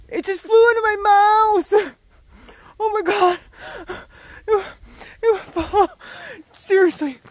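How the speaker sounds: loud shocked outburst; a bug just flew in your mouth; shocked yelp. Real, not acted.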